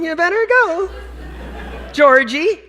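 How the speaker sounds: hammed-up mom calling her boy; imitating mom. Mother urging son Georgie off to school, mock high voice. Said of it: "She called me Georgie".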